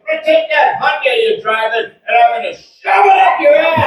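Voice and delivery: high-pitched shout, building in force; re-enacted road-rage threat. Angry driver threatening the comedian, word for word, mock shout. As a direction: act out an escalating driver's threat. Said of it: "this is motion for motion".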